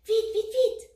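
high, loud, rapid-fire urging; urgent hurrying. A narrator's reading. Bonnie jumping into the box, urging Teddy. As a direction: hurry him along. Rabbit hurries sleepy bear.

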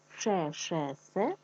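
Ше-ше-се said slowly, each syllable set apart; Ше-ше-се spoken slowly, syllable by syllable, for children to copy; slow syllable drill. Shown as a demonstration. Teacher drills kids on a tongue twister, slow syllables. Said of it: "я шкарпеточки сушу".

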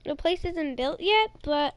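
ordinary talk, nothing marked

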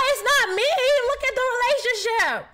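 high, loud, delighted disbelief; thrilled squeal. Real, not acted. Streamer squeals as the romance meter climbs, high and loud. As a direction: squeal over the meter going up.

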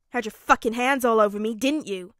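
loud, heated accusation; angry accusation. Acted. Bakugo accusing Midoriya of touching him, angry. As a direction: throw the accusation at full volume.

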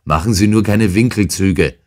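rough shout. Acted. Coarse intruder warning Holmes off evasions, shouting. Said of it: "rief jetzt der grobe Kerl".

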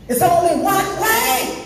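high, slow rising declaration; preacher's emphasis. Real, not acted. Preacher insists on the only road to heaven, high drawn-out cry. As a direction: stretch it out high to drive it home. Preacher repeats line with force.

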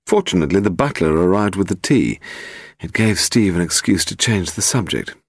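plain reading voice

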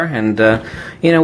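ordinary talk, nothing marked

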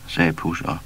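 ordinary delivery

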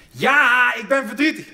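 a hammed-up wife snapping back at last; wife's upset outburst.

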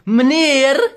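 high, loud "Meneer?" rising; exasperated high shout to get his attention; loud call. Retold or imitated. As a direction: call out to him sharply. Confronting the man.

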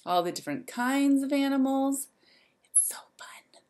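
excited hushed whisper. Acted. Teacher whispering to the kids about the fun zoo. Aside to children.

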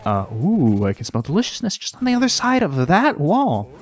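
goofy character voice. Pretend. Character voice smelling food.

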